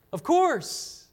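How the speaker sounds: ordinary talk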